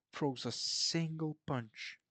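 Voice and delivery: ordinary talk, nothing marked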